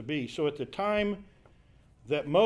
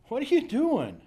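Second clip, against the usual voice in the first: much higher, louder put-on incredulous voice; mock exasperated question; 9 semitones higher, 4 dB louder than usual. Preacher playing the angel as exasperated with Hagar, high mock incredulity. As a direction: throw it up high like a baffled scold. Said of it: "read it like we might think of it today".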